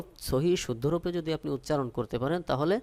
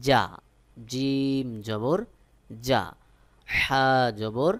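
letter drill recitation; much slower than usual. Shown as a demonstration. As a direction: call out each letter like a teacher drilling a class. Teacher drills Arabic letter sounds.

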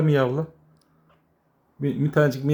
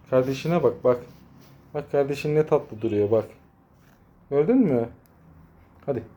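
ordinary talk

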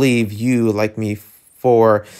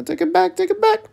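very high squeaky DJ scratch imitation; playful turntable mimicry; faster than usual. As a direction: squeak it out like a record rewinding. Playing at DJ with turntables.

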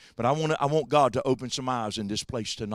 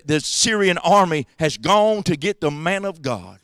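usual speaking voice

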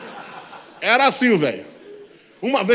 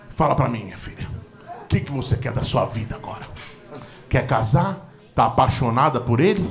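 low, softened mock-tender questioning; gentle father; 5 semitones lower than usual. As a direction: imitate a dad suddenly calm and careful. Father gently questions pregnant daughter.

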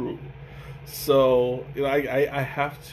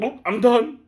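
high, brisk quitting quote; mock hasty quit; 7 semitones higher, 4 dB louder than usual. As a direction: toss it off impatiently. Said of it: "It's like".

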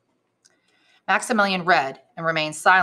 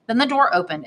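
plain reading voice

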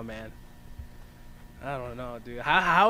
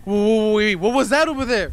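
higher, louder startled alarm; alarmed surprise; 5 semitones higher, 7 dB louder than usual. Real, not acted. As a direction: call out at a sudden sighting. Spots something in the video.

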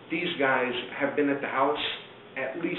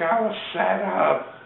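slow, raised imitated wail; mock protest; 4 semitones higher, slower than usual. Charlie being arrested, slow drawn-out mock protest. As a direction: stretch out his complaint, hammed-up.

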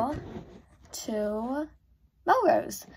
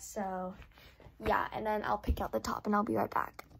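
quiet, away from camera; 9 dB quieter than usual. Real, not acted. Talks low, away from mic.

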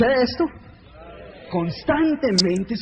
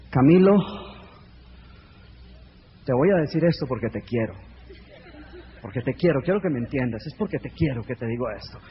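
lower, softer, condescending; patronizing friend; 9 semitones lower, 4 dB quieter than usual. Pretend. A friend warning Camilo about his dreams, lower and patronizing. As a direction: talk down gently like a know-it-all friend.

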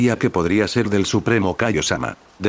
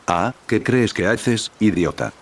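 usual speaking voice

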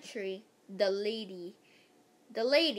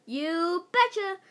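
higher, louder, slow drawn-out cheer; chirpy vice principal; 6 semitones higher, 6 dB louder than usual. Acted. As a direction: stretch it like a peppy teacher. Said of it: "said Miss Jaffe".

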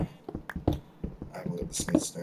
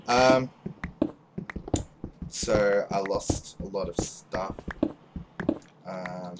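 usual speaking voice

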